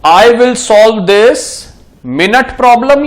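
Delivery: "minute" spelled out slowly as my-NEWT; slow careful reading. Shown as a demonstration. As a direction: read the example deliberately for students to repeat. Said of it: "I really want you to pronounce it". (धीरे-धीरे साफ उच्चारण)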